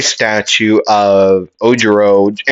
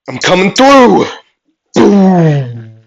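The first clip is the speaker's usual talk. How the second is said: high, loud warning into a boom; battle shout; 8 semitones higher, 5 dB louder, slower than usual. Acted. Character blasting through toward opponent, shouted. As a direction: holler it, then make the blast.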